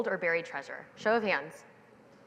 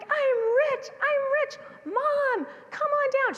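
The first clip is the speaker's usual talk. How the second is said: put-on very high, loud child's shout; ecstatic kid yelling; 4 dB louder than usual. Little girl calling mom down to see her gold, high and ecstatic. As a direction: yell it to mom up the hill, overjoyed. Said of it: "I'm like".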